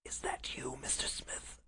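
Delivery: faint sickbed whisper. Acted. Sick Holmes greets his visitor, feeble whisper. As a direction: whisper weakly, barely any voice. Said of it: "Holmes whispered".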